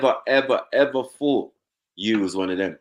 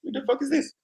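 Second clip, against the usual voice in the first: indignant; 5 semitones higher than usual. Retold or imitated.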